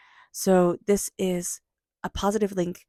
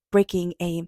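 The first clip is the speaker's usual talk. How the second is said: usual speaking voice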